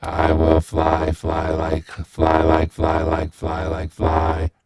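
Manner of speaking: deep, slow robotic vocoder; drawn-out robot voice. Shown as a demonstration. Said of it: "Turn the vocoder on".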